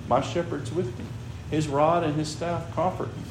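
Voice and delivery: usual speaking voice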